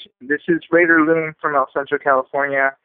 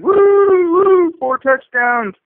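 mock fan cheer; 9 semitones higher, 4 dB louder, much slower than usual. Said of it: "everyone's gonna be like".

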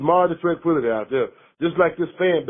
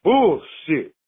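angry rant; slower than usual. Real, not acted. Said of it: "I love that rant".

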